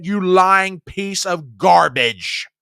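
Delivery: loud and slow, contemptuous; furious insult. Real, not acted. Host insulting the president, loud and contemptuous. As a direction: snarl it at him.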